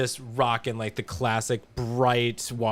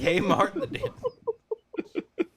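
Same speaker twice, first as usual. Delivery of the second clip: high drawn-out mock squeal; much slower than usual. Mocking Jen's line.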